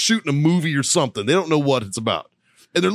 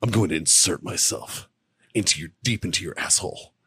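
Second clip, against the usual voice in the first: mock a creep murmuring sweet nothings; imitating a creepy seducer, low and hushed; creepy seductive whisper; 5 semitones lower, 4 dB quieter than usual. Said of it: "he's like".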